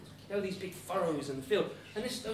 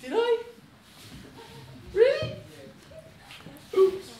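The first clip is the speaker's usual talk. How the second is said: high, loud squeaks of realisation; comic alarm; 13 semitones higher, 7 dB louder, slower than usual. Acted. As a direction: squeak it out in mock dismay. Hans realises his mistake, played comically.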